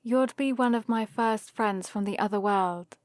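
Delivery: usual speaking voice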